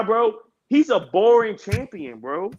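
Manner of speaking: ordinary talk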